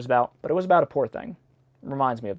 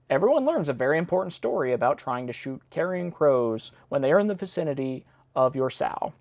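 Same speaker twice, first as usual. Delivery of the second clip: ordinary talk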